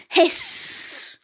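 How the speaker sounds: long, drawn-out hiss; imitated cat hiss. A narrator's reading. Cats bare fangs at the intruders, hissing.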